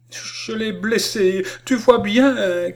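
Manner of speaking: put-on high, drawn-out child's cry of distress; cri entrecoupé. A narrator's reading. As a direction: cry it out slowly, high-pitched and upset. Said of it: "cria-t-elle d'une voix entrecoupée". (cri de détresse aigu, voix entrecoupée)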